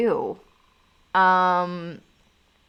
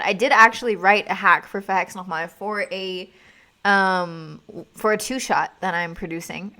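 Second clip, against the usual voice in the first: ordinary talk, nothing marked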